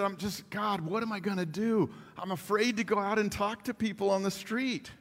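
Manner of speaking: mildly anxious, pleading prayer; scared plea. Retold or imitated. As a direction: imitate a frightened man praying. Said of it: "this guy was scared to death".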